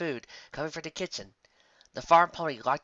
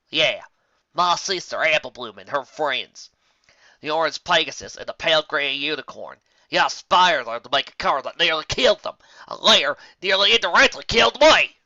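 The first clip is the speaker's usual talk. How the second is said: furious; 4 semitones higher, 11 dB louder than usual. Acted. Applejack lists her sister's friends, louder and furious. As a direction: let the rage climb.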